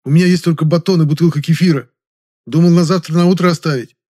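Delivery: high, loud fretful explanation; preoccupied concern. A narrator's reading. Жасминов lists his meagre food, concerned. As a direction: fuss over what little there is. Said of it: "озабоченно вспомнил Жасминов".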